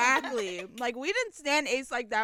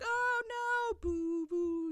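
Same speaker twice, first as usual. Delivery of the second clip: higher, playful fan boo; mock fan booing; 10 semitones higher, much slower than usual. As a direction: boo like a disappointed fan, half joking. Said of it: "as fans do".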